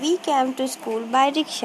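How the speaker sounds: slow dictation. Shown as a demonstration. Teacher dictating example sentence.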